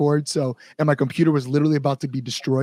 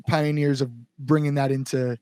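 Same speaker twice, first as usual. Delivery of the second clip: usual speaking voice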